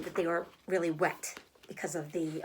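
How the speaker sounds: ordinary delivery, nothing marked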